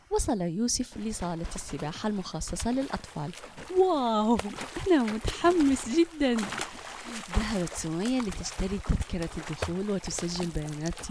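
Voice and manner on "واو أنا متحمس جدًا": put-on high, bright burst of a child's excitement; a kid bursting with excitement, louder; excited child. Boy at the pool entrance, excited and loud.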